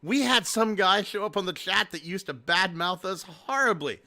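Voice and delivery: ordinary talk, nothing marked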